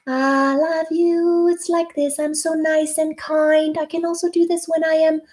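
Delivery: a coach showing a sweet talking tone; nice and kind sound. Shown as a demonstration. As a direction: speak it sweetly, like a kind friend. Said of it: "call it the nice and kind sound".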